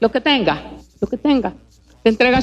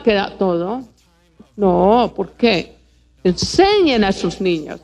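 ordinary talk

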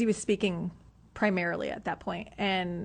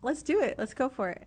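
eager yes; 7 semitones higher than usual. Retold or imitated. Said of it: "and I'm like".

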